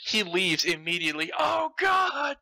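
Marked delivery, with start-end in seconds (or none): 1.4-2.4 hammed-up cry of despair; anguished cry. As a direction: cry out as he walks out the door. Impa cries out as he leaves.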